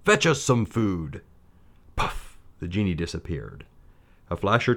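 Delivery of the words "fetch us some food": sultan-like order. A narrator's reading. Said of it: "as if he were the Sultan himself".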